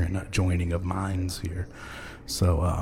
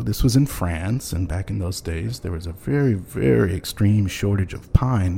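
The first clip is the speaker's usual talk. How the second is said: ordinary talk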